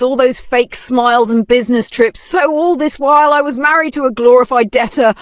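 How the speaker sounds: high, loud furious cursing; cursing in rage. Acted. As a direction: spit out curses through a twisted face. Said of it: "began cursing the air, face twisted with rage".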